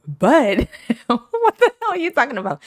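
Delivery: high, loud and fast, laughing in disbelief; incredulous. Retold or imitated. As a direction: blurt it out in amused shock. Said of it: "I said".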